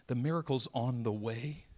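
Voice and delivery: usual speaking voice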